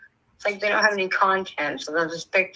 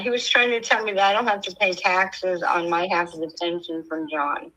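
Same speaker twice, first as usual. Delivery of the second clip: normal talking voice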